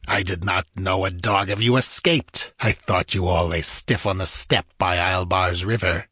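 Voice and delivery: lightly voiced disdainful nobleman; haughty lord. A narrator's reading. Lord sneering at the escaped Cossack, lightly voiced. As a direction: sneer down at the survivor. Shah Amurath speaks.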